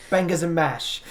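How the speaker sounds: a hint of cockney on "bangers and mash"; mock British accent. Teasing a player's British character, mock London accent. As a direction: throw in a jokey London accent. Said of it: "embarrassed by his accent".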